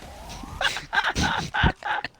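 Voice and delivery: loud, high, breath-heavy laugh; wheezing laughter. Real, not acted. Laughing at own prank idea, wheezing laughter. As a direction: crack up into a long wheeze.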